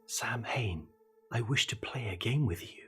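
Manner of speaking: soft, voiced murmur of an invocation; hushed near-whisper. A narrator's reading. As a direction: say it quietly, as if summoning someone in secret. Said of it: "and whisper".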